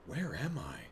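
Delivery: soft, hushed imitation of a film line; half-whispered, softly puzzled mimicry; quiet whispering. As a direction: say it small and under the breath. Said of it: "kind of a quiet whispering".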